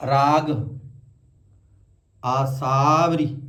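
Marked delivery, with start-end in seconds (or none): 2.2-3.4 ਆਸਾਵਰੀ slow, long saa flowing into vri; aa-saavri. Shown as a demonstration. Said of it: "ਆਸਾ ਅਲੱਗ ਨਹੀਂ ਕਰਨਾ".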